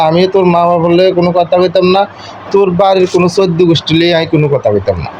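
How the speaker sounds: normal talking voice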